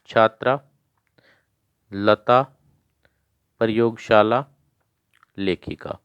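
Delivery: each word called out clearly for the class to repeat; loud recitation drill. Shown as a demonstration. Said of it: "जिनको बोलना नहीं आता वो मेरे साथ".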